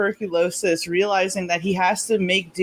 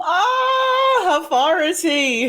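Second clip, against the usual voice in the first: excited; 9 semitones higher, 4 dB louder, much slower than usual. Real, not acted. Hearing a friend plays favorite game.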